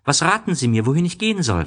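the little prince pleading for advice, high and loud; little prince asking. Acted. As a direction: ask it like an eager small boy, pitched high. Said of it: "fragte er".

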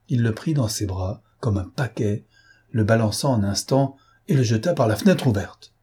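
usual speaking voice

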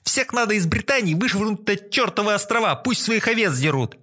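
high furious tirade; angry rant. A narrator's reading. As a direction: rant with contempt. Student ranting on.

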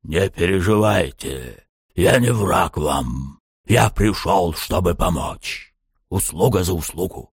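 slow voice from the underworld; slow, hollow demonic voice; deep echoing underworld god. Acted. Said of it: "голосом словно из преисподней".

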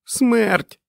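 very high piercing cry; screamed. A narrator's reading. Fran answers Stu in panic, screaming. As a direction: cry it out in horror. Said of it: "закричала вона до нього".

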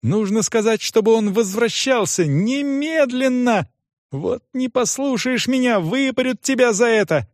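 put-on high-pitched girl giving frantic orders; crying out urgently. A narrator's reading. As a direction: insist and threaten in a high voice. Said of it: "крикнула девочка".

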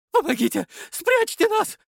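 high panicked cry for help; terrified screaming. Acted. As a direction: beg to be hidden, screaming. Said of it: "вопил самый толстый". (испуганный крик)

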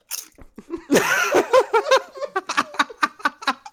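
high-pitched long burst of laughter. Real, not acted. Co-host cracking up at the missing one, long high laugh.

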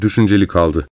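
ordinary talk, nothing marked

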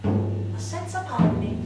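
usual speaking voice

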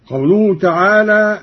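ordinary talk, nothing marked

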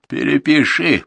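loud, high rasped command; hoarse order. Acted. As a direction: rasp the order at him. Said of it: "прохрипел он".

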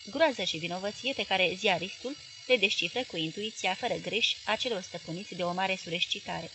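ordinary delivery

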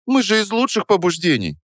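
put-on high, hurt girlish protest; lightly acted offended girl; pouty offence. A narrator's reading. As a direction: protest with a wounded pout. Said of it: "обиделась Дарья, надув губки".

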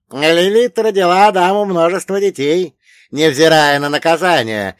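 high, loud elder quizzing a pupil; old storyteller pitched high and forceful; wise old dead man. Acted. Old man continues the legend.

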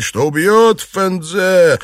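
loud, high, drawn-out threat; shouted threat. A narrator's reading. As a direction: shout it slowly and fiercely. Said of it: "стал кричать".

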